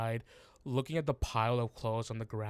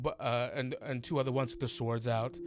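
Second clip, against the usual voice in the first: usual speaking voice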